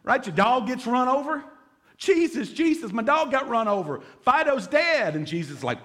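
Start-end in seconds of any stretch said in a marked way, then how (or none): 2.0-5.1 high-pitched, hammed-up cry for help; mock distressed plea. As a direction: play a kid begging over a dead pet. Acts out pleading over a dead dog.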